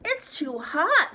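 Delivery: high, loud, drawn-out pretend complaint; lightly voiced Mama Bear fussing. A narrator's reading. Tara tasting pretend porridge as Mama Bear, loud complaint. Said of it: "first as Mama Bear".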